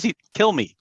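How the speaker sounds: kill me pitched high and desperate; fed-up plea. Retold or imitated. Teacher retells Moshe begging God to end it, pitched high. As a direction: throw up your hands and beg. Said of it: "Moshe's so fed up".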